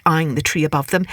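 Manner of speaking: ordinary delivery, nothing marked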